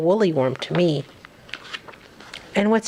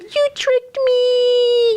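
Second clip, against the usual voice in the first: much higher, louder, slow accusation; dramatic cry; 3 dB louder, much slower than usual. Shown as a demonstration. Parent acts the tricked animal for the child, slow high wail. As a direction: wail it out, hammed up. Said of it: "not being very dramatic".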